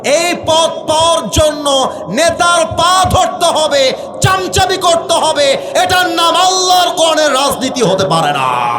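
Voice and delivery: preaching at full force, high and loud, drawn out; rising hellfire preaching cadence; fiery sermon. Acted. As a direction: thunder it at the crowd like a waz preacher. Fiery waz preaching over music.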